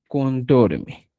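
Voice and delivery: Contorme, ending clearly in -mi; quechua word. Shown as a demonstration. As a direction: model Contorme with the added mi. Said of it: "le agrego el mi". (pronunciación de Contorme con el sufijo mi)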